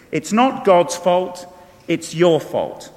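slow, stressed YOUR fault; pointed emphasis. Real, not acted. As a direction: lay the blame squarely, slowly.

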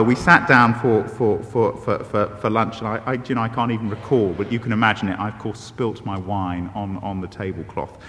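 normal talking voice

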